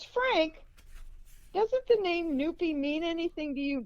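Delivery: high, leading mother's voice; teasingly significant retold question; imitated pointed question. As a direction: call his name high, then keep the question up high.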